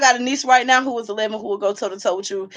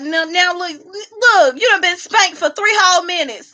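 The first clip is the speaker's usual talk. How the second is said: mock scolding; 6 semitones higher than usual. Real, not acted. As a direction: scold him like a fussing aunt. Mock scolding troll, higher, louder.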